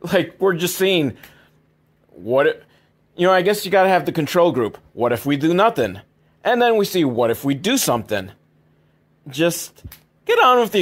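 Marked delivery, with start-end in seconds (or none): none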